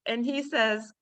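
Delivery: ordinary talk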